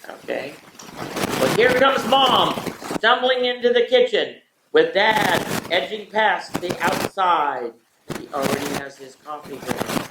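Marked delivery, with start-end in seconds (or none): 1.4-7.7 raised, excited play-by-play call; race announcer. Acted. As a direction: call the start like a horse race. Mock horse-race call of family morning.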